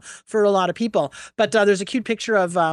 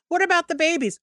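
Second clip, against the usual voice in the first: mousy activist; 10 semitones higher than usual. Retold or imitated. Activist voiced again, pitched up.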